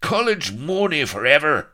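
high, loud Irish war cry; cornered Irish rogue bellowing his battle cry. Acted. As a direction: roar it like a last charge.

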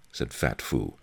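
ordinary delivery

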